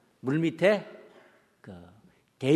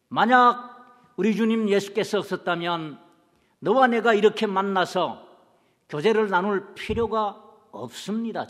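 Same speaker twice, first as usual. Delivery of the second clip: louder, higher fervent preaching; rising preaching; 4 semitones higher, 4 dB louder than usual. Acted. Pastor urging the church of Christ, fervent louder preaching. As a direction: build it up like a pulpit climax. Sermon climax to the congregation.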